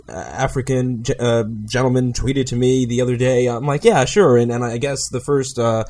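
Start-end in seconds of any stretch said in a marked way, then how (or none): 3.8-4.4 sarcastic. Retold or imitated. Mocking a claim he doubts.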